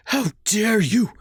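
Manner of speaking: high, slow furious outburst; growling. A narrator's reading. Mother furious at her son for blaming his father. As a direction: spit it out in outrage. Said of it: "she growled".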